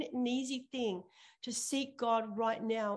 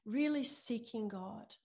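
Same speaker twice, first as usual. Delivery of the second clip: ordinary delivery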